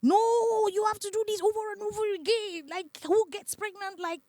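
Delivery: very high, loud frantic denial; a boyfriend rejecting the news, frantic and high; boyfriend's denial. Retold or imitated. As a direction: deny it in a panicked high voice. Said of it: "I saw a different person".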